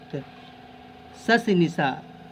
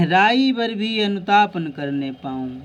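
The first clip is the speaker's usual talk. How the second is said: poem recitation; 2 semitones higher, 4 dB louder than usual. Acted.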